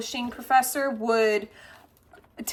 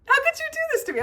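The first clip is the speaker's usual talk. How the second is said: high-pitched retold wail of reproach; mock outrage; much faster than usual. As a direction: squeal the accusation as playful outrage. Acted outraged reproach to friend.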